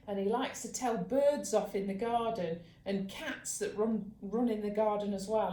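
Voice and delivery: ordinary delivery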